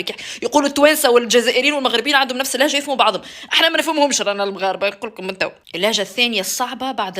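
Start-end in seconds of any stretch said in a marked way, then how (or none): none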